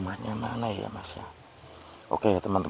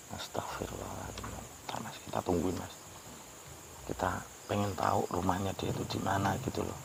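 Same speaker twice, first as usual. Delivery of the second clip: soft whispered astagfirullah, much softer; hushed, frightened muttering; whispered prayer; 11 dB quieter than usual. Real, not acted. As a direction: whisper it, spooked. Frightened at a graveyard at night.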